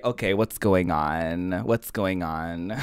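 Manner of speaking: low-pitched auntie fishing for gossip; lightly acted confiding auntie; nosy auntie. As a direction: play the auntie, low and prying. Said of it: "I'm the auntie who's like".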